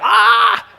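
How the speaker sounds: sudden "ah" of mock pain; imitated pained yelp. Dad imitating son when tooth wouldn't come out, mock yelp. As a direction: yelp like a kid whose tooth got tugged. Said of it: "And he's like".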